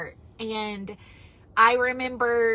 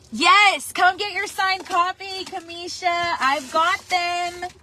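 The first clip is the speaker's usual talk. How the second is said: excited call; 6 semitones higher, 3 dB louder, slower than usual. Real, not acted. Calling to a viewer on a live.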